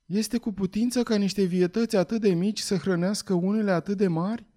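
a lightly voiced child crying out; young boy exclaiming. A narrator's reading. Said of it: "strigă Jack". (băiețel uimit, vocea mai sus)

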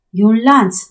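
plain reading voice

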